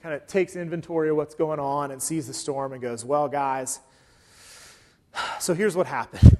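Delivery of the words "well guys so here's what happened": sigh, then confess; sheepish explanation with a heavy sigh; reluctant Jonah. Acted. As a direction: own up reluctantly to the group. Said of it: "kinda reluctantly".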